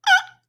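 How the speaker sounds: high, loud mock gasp; startled gasp. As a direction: imitate someone suddenly scared. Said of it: "That's when you're like".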